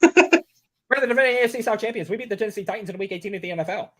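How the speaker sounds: an excited boss blurting out his booking plans; hyper, breathless mock promoter; Tony Khan voice. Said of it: "it'd go something like this".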